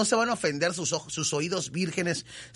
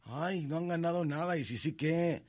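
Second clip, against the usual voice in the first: soft, sneering mock whine; a quieter imitation of a sour critic; mocking the bitter fans; 2 semitones higher, 6 dB quieter than usual. Host mimicking complainers who say Mexico won nothing. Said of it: "Aquellos mamadores que andan".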